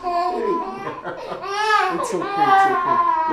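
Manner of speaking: crying. Acted. Pleading, laughing through tears.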